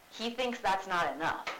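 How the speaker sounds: ordinary talk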